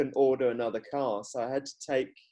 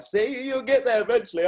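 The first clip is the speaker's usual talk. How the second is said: instructor voice; 11 semitones higher, 5 dB louder than usual. Retold or imitated. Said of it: "my driving instructor was like".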